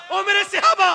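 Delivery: high acted summons to companions; storyteller voicing a high, ringing call. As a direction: call out to your followers, pitched well up. Acting the Prophet calling his companions.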